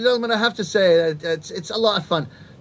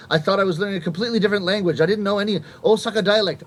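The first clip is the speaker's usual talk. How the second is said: ordinary talk, nothing marked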